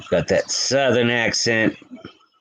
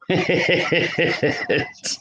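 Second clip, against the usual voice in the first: light giggle, pitched up; high airy laugh; 7 semitones higher than usual. Real, not acted. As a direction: let out a breathy little laugh. Laughs at hunting camp memory.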